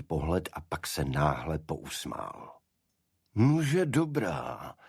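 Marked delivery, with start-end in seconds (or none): 3.3-4.7 slow, higher, amused giving in; yielding Holmes. A narrator's reading. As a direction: concede with a slow smile. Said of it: "podvolil se". (pomalé, pobavené svolení)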